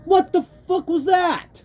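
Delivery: furious gamer shouting at the game; outraged shout. Real, not acted. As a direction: yell it at the screen in disbelief.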